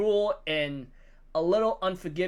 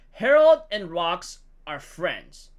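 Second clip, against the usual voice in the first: usual speaking voice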